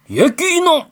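put-on high scolding old man. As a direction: scold like a grandpa. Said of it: "할아버지의 큰 목소리".

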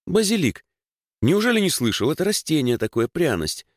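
put-on high-pitched girl's disbelief, settling as she explains; incredulous explaining. A narrator's reading. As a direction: marvel that he hasn't heard of it. Dasha amazed he doesn't know basil.

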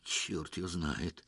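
slow, low and soft doubt; hesitant, quiet, drawn-out reply; doubtful musing. A narrator's reading. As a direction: muse it uncertainly, keeping it down. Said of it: "с сомнением молвил он".

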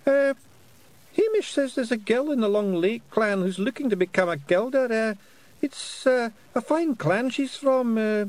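high halting bashful pixie; embarrassed pixie. A narrator's reading. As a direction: hem and haw, embarrassed. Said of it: "violet with embarrassment".

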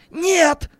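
high, loud panicked no; sudden cry. A narrator's reading. Businessman remembers the danger, crying out in panic. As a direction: cry out in alarm. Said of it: "выкрикнул Леонид Владимирович".

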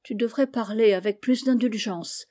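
ordinary delivery, nothing marked

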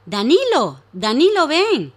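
calling loudly across the garden. Acted. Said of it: "lo llama desde el jardín".

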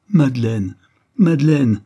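loud, rising despairing appeal; despairing call. A narrator's reading. Count on his knees before Madeleine, despairing call.

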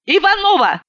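high-pitched outraged call; indignant cry. A narrator's reading. Outraged woman calls out to her friend, shouting. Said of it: "закричала я".

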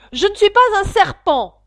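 high, loud indignant protest; Alice snaps back, offended. Acted. Alice protesting to the pigeon, loud and indignant. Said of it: "dit Alice avec indignation".

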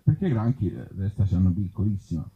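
ordinary talk, nothing marked

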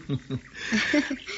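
soft, high little laugh; amused laugh. Acted. Amused by his friend's wry remark, soft high laugh. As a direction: let a light laugh slip out.

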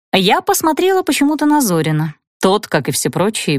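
plain reading voice

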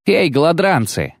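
high, ringing knightly challenge; ringing roar. A narrator's reading. Knight hailing the ragamuffins, ringing roar. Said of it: "Звонко рыкнул один из рыцарей".

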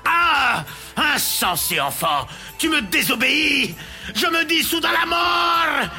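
furious magician. Acted. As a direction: curse him, drawing out the last words. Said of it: "ivre de colère".

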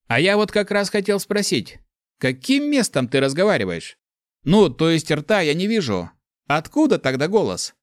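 high, loud faux-innocent questions; mock curiosity. A narrator's reading. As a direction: play dumb, teasingly curious. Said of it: "Почти искренне заинтересовался бес". (притворное любопытство)

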